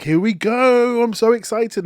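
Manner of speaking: high, loud and slow, bursting with glee; giddy excitement. Retold or imitated. Reviewer reliving the cinema moment, giddy and thrilled. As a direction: let the thrill spill out on every word. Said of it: "I was giddy with excitement in the cinema".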